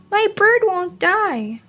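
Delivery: put-on a small boy digging in his heels; insistent child. Mo insists against his parents.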